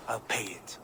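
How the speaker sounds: hushed, grim resolve under the breath; low whispered resolve; whispered vow. Acted. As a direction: breathe it out as a quiet promise. Vow to pay the price.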